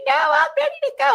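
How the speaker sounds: put-on high, loud panicked child voice; mock-panicked son pleading; panicking kid. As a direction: squeal it like a frantic little boy.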